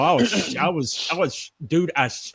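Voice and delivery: normal talking voice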